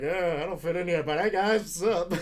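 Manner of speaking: high, loud forced greeting; nervous upbeat hello; awkward fake cheer. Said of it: "I'm like".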